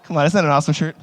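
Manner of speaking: ordinary talk, nothing marked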